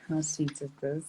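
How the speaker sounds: normal talking voice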